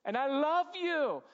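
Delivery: high, loud and tender, the last words slow; a parent's joyful, tender I forgive you; warm forgiveness. Shown as a demonstration. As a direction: beam out forgiveness to a sorry child. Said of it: "I can immediately at this point be like".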